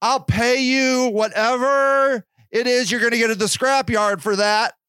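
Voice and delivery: calling out. Retold or imitated. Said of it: "I'm like".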